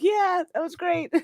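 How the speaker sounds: high, breathless laughing delight; giddy laugh after the slide. Real, not acted. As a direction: laugh it out, thrilled.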